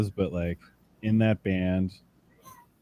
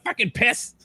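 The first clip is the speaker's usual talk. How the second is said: higher mock drummer yell; imitating the drummer; 13 semitones higher than usual. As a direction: do the drummer's squeal again. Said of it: "your drummer voice".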